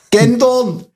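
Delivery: exclaimed Saxon "Gändorn", high-pitched; Saxon dialect. Shown as a demonstration. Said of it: "wir sagen gendern".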